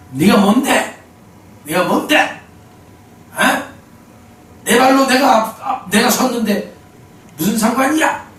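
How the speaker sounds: put-on snapping old man. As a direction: play the old man biting back, hammed-up. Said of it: "막 이렇게 쏘아붙이는 거예요".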